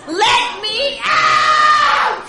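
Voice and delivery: very high, loud drawn-out yell; a desperate bellow; furious shout. Retold or imitated. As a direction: scream to be let out. Yelling at husband in car.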